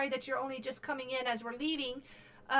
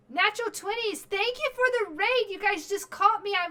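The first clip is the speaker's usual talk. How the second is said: excited; 7 semitones higher, 5 dB louder than usual. Real, not acted. Streamer greets incoming raid.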